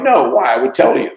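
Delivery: loud, high, caught-off-guard no; retold surprised denial to girlfriend, loud and high; startled denial. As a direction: blurt it out defensively, surprised. Defensive reply to girlfriend's question.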